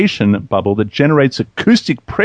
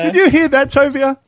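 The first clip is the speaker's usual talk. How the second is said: high-pitched stunned question; real astonishment; 11 semitones higher than usual. Real, not acted. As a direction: check in amazement whether others heard it. Reacting to sudden loud noise.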